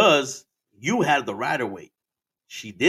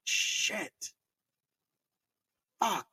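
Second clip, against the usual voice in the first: whispered curse; 7 semitones higher, 8 dB quieter than usual. Retold or imitated. Dismay at a crash scene.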